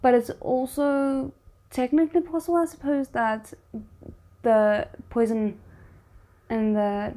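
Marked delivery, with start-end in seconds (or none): none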